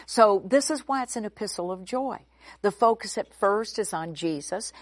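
ordinary delivery

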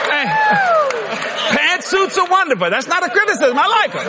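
high-pitched mock defensiveness; high, insistent joking disclaimer; playful backpedalling. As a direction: placate the crowd, pitch way up. Backpedals to a riled crowd.

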